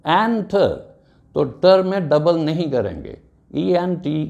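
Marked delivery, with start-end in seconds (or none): 0.1-0.7 enter with एन pitched high, then falling; stress on first syllable. Shown as a demonstration. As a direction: say enter as EN-ter. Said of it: "stress है एन पर".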